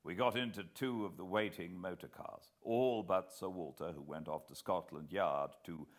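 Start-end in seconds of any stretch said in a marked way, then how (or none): none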